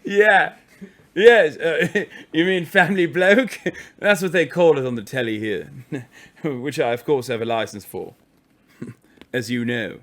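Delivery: put-on posh English accent broken by laughter; amused mock-posh English; laughing in posh accent. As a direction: keep the posh English act going through giggles. Laughing through the posh act.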